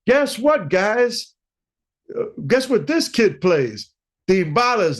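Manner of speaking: high, loud announcing to the band; playful ribbing, calling out to the guys; imitating the bandleader showing off the kid to his band; teasing bandleader. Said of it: "clowning me like something serious".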